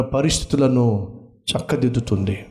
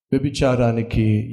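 normal talking voice

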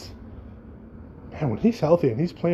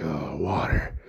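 much lower, disdainful grumble at water; disgusted fire dad; 10 semitones lower, 5 dB quieter, slower than usual. Retold or imitated. Host mimicking Bernie's disdain for water, low sneer. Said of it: "he's like".

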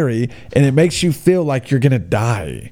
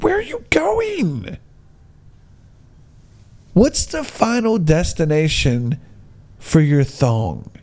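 incredulous; 3 semitones higher, slower than usual. Real, not acted. Ranting at passing girls.

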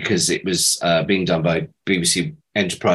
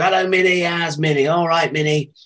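greeting in a mock Lancashire voice; 8 semitones higher than usual. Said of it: "he'd always go".

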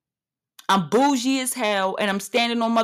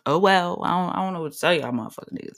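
usual speaking voice

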